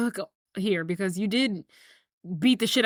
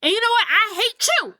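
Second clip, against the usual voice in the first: raised, high-pitched acted lashing out; angry outburst; 10 semitones higher, 4 dB louder than usual. Son lashes out at his father in a quarrel, angry. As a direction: hurl it as an acted blow.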